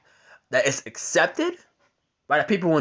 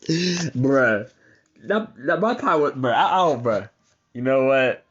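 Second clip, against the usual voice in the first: ordinary delivery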